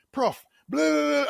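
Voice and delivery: high, loud brash greeting; brash student. Retold or imitated. Young Lyle bursting into the dean's office, brash and high. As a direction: barge in full of yourself.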